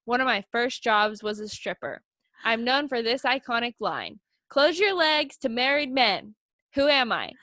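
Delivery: ordinary talk, nothing marked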